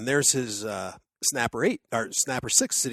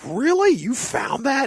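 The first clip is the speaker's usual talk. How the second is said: incredulous; 12 semitones higher, 5 dB louder, slower than usual. Retold or imitated. Doubting friend's story.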